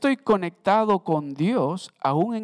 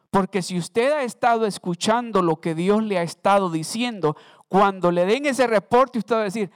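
louder, rising preacher's build; full-force preaching; 4 semitones higher, 5 dB louder than usual. Acted. Preacher rousing the church about hard times, loud and fervent. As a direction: drive it home to the congregation.

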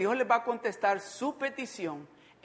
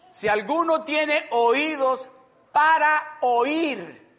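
loud, high, very slow reading; proclaimed scripture; 8 semitones higher, 9 dB louder, much slower than usual. Acted. Preacher reading Mark to the congregation, proclaiming. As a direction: proclaim it slowly, stretching the final words.

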